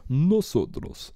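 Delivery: slow, careful Spanish "Nosotros" with rolled r; Spanish pronunciation. Shown as a demonstration. Friend demonstrating the right Spanish "Nosotros", slow. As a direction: say "Nosotros" slowly, syllable by syllable. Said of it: "if you're the male".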